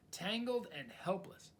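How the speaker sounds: ordinary delivery, nothing marked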